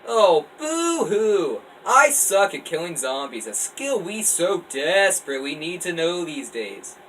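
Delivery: loud mocking boo-hoo, drawn out; mock him with exaggerated sarcasm; sarcastic. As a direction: Kyle jeering at his friend's teasing. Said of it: "trying to be as sarcastic as he can".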